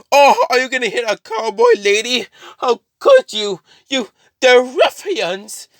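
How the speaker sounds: very high, put-on outraged voice; hammed-up wounded lady; affronted cowboy lady. Carnage plays affronted cowboy lady.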